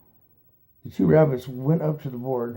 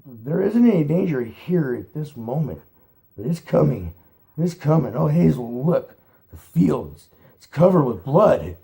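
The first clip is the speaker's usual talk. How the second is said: higher, louder panicked warning; terrified; 4 semitones higher, 9 dB louder than usual. A narrator's reading. Fiver warning Hazel, loud terrified vision. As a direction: cry out the vision in terror.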